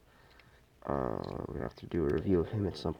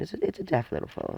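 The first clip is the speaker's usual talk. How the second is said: chirpy high line; 10 semitones higher, faster than usual. Real, not acted. Repeats phrase, second take whispered.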